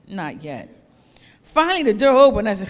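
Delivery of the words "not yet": quiet, low and gentle; calm refusal, softly; soft, gentle master. Acted. Potter quietly tells the clay not yet, gently.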